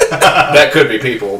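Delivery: giggly, amused aside; laughing. Real, not acted. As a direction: crack up and squeeze the joke out. Joking about cheap burgers.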